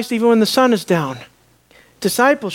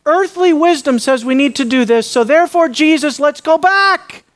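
eager Peter; 7 semitones higher, 4 dB louder than usual. Acted. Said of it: "Peter thinks".

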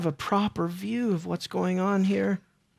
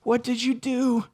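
Peter pleading gently; 3 semitones higher than usual. Retold or imitated. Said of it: "what was going on through his mind".

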